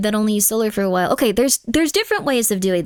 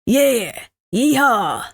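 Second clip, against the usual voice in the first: a hint of a Texan survivalist; fake cowboy accent. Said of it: "This is like".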